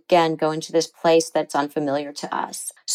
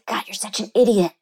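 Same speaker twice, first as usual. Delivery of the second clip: harsh self-critical; 2 semitones higher than usual. Retold or imitated. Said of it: "just horrible tone to herself".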